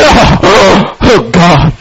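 mock agonised groan; anguished worrier. Sleepless worrier beset by thoughts, groaning in mock anguish. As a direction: groan it out like someone tormented by worry.

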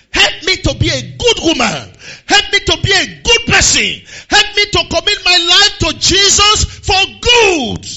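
loud, high preaching cry; shouted prayer. Real, not acted. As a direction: cry out the prayer at full force. Leads congregation in loud prayer.

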